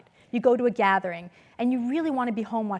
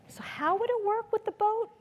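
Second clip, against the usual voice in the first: man's question, pitched higher; retelling the man's question; 10 semitones higher than usual. Retold or imitated. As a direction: voice him lightly and higher. Retold man's quiet question.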